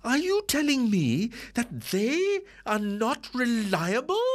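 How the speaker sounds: high, slowed, indignant insistence; indignant wife. Acted.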